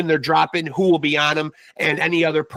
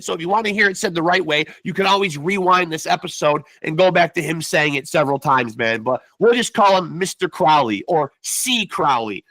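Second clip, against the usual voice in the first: ordinary talk, nothing marked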